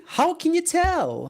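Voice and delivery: exaggerated sarcastic squeak. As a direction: ask it dripping with sarcasm. Sarcastic question about envy.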